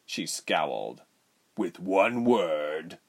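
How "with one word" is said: threatening. A narrator's reading. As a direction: drag it out as a warning. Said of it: "Rubens threatened".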